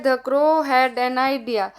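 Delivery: very slow, word-by-word English reading. Shown as a demonstration. Teacher reads the worksheet sentence to children, very slow and deliberate. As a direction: spell it out slowly for the kids.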